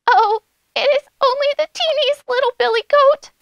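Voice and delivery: tiny, high-pitched little goat; very small goat voice. A narrator's reading. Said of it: "in his very small voice".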